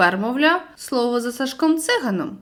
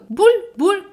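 bubbling; 7 semitones higher, 5 dB louder than usual. Acted. Sinking underwater.

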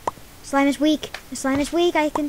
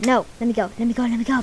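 ordinary delivery

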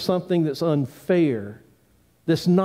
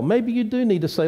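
ordinary talk

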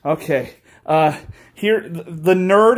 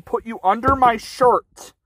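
ordinary talk

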